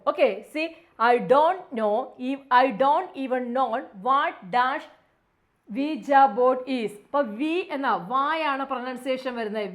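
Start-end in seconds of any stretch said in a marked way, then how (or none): 1.1-6.9 slow reading, വി ജ board louder; slow read-aloud. Shown as a demonstration. Teacher rereading the question with Ouija pronounced correctly. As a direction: read slowly, land on വി ജ board. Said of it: "reads corrected question".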